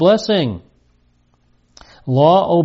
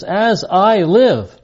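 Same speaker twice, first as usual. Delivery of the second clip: slow, raised, weighty oath; solemn oath; 5 semitones higher, 3 dB louder, much slower than usual. Retold or imitated. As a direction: swear it solemnly, drawing out each word. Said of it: "This is a solemn oath".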